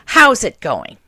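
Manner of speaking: a rough, hearty question; loud ragged old aunt. Retold or imitated. Aunt asking, voiced.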